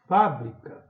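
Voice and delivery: FÁ loud in fábrica; stressed first syllable. Shown as a demonstration. Teacher calling the word for pupils, stressed FÁ in fábrica. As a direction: call "fábrica", punching FÁ. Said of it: "Pra chamar a palavra".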